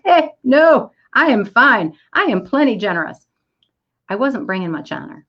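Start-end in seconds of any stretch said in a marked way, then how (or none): 0.0-3.2 firm refusal. Retold or imitated. Retold firm refusal to the coaxer.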